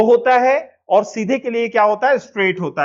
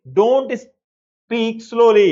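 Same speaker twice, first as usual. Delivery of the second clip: very slow, word by word model sentence; slow dictation; much slower than usual. Shown as a demonstration. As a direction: spell it out slowly for learners. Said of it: "क्या बोलेंगे".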